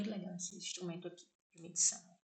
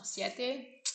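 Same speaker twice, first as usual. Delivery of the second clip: ordinary talk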